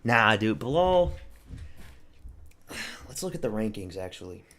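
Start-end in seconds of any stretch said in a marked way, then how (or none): 0.6-1.1 mocking name. Mock name again.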